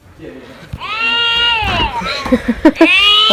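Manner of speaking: loud, high, helpless laugh; exasperated laugh. Real, not acted. Tired mum laughing as the baby growls at her, loud helpless laugh.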